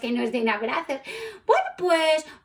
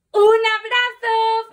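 high, loud, stretched-out question; eager question; 8 semitones higher, 5 dB louder, slower than usual. A narrator's reading. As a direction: ask it hopefully, pitched right up. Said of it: "preguntó Mauro".

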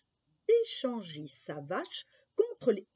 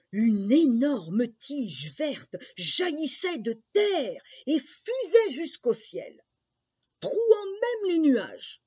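slow, stretched words climbing ever higher and louder; mounting wonder; 7 semitones higher, 6 dB louder, slower than usual. A narrator's reading. Narrator shows the stalk shooting through clouds, rising wonder. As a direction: build in awe up to a soaring finish.

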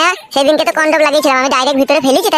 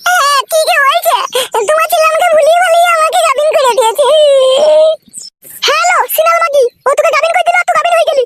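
much higher woman's voice, scolding; a much higher woman's voice, scolding and accusing; shrill village woman; 14 semitones higher than usual. Acted. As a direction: play the wife lashing out at the girl. Female character voiced high.

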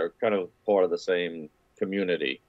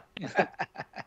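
soft laugh; 11 semitones higher, 6 dB quieter than usual. Real, not acted. Laughs at own accent.

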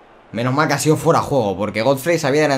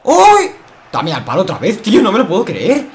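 exasperated shout; 6 semitones higher, 6 dB louder than usual. Real, not acted. Hits post again.

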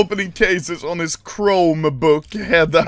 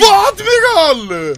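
high, slow, loud exclamation; stretched-out high yell; incredulous shout; 11 semitones higher, 5 dB louder, slower than usual. Real, not acted. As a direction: shout the name in disbelief. Exclaiming at teammate's move.

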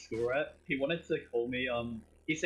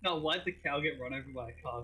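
ordinary delivery

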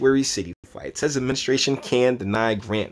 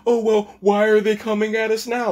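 high, whiny mock innocence; mocking the official; 11 semitones higher, 4 dB louder than usual. Vlogger mocking the attorney general's complaint, high and whiny. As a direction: act wounded and puzzled in a high voice. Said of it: "he comes out and says".

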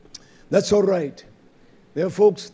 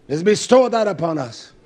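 ordinary delivery

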